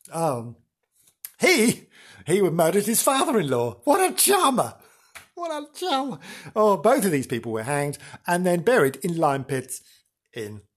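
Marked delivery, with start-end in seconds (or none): 3.8-4.7 high mock-admiring sneer; a high sarcastic what a charmer. Real, not acted. Sarcasm on a murderer. 5.3-6.2 high sarcastic echo, softer; high hushed sarcastic charmer. Real, not acted.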